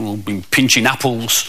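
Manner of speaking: low-pitched, sheepish confession; lightly voiced sheepish kid. Naughty pupil confesses to a visitor, sheepish. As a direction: own up like a sorry pupil.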